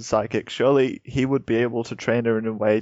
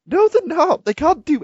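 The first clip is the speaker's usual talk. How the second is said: emphatic; 6 dB louder than usual. Real, not acted. Heated argument with co-host.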